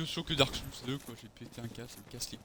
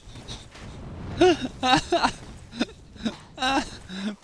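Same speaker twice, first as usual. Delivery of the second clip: loud high laugh; 9 semitones higher, 5 dB louder than usual. Real, not acted. Startled, then laughs it off.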